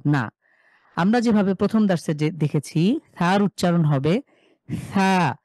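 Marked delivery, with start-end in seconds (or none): 4.9-5.4 থা with tongue between teeth and a light breath; soft th letter. Shown as a demonstration. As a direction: say থা softly, tongue touching the front teeth. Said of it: "জিভকে বের করব, সামনের দাঁতের সাথে লাগবে". (জিভ দাঁতে লাগিয়ে হালকা বাতাসে থা)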